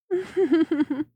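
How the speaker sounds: a short playful laugh after a tease; teasing chuckle. Acted. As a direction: chuckle at your own tease. Teasing about keeping client bound.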